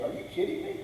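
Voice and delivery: hammed-up disbelief; incredulous. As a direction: react like the idea is absurd. Jacob refuses to send Benjamin.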